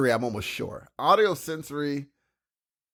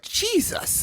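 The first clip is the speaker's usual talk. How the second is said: breathy awed whisper, much higher; whispered Jesus; 6 dB quieter than usual. Retold or imitated. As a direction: gasp it out under your breath. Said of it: "He's like".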